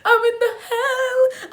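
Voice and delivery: high, slow, wailing half-sob; crying despair. Retold or imitated. Hiding from matrons, wailing in mock despair. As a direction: wail it like you're doomed.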